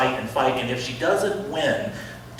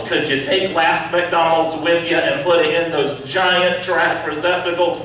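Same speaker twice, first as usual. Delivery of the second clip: ordinary talk, nothing marked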